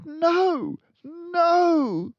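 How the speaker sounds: high, loud protesting teacher; alarmed young teacher; crying out. A narrator's reading. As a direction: cry out to stop her. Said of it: "cried Miss Honey".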